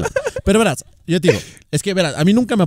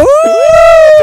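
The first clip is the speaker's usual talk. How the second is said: 1 grito agudo; 11 dB louder than usual. Real, not acted. Celebra dos videos.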